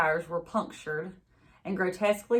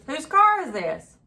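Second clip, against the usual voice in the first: higher, louder demand; sheriff questioning; 9 semitones higher, 7 dB louder than usual. A narrator's reading. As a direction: press her for an answer.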